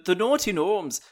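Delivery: put-on loud Irish accent; cheeky, loud Irish voice. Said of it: "I went Irish there".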